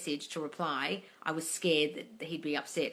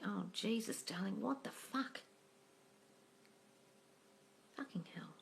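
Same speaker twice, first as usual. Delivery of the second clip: startled whisper; 14 dB quieter than usual. Real, not acted. A bee in her apartment.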